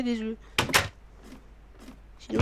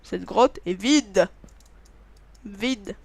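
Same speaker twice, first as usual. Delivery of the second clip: very slow, lower mock-solemn statement; slow drama; 3 semitones lower, much slower than usual. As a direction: drag it out like a grim verdict. Dramatic cave declaration.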